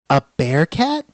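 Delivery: high, surprised princess; doubtful young princess; cartoon princess. Princess character in story.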